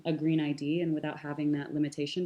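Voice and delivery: normal talking voice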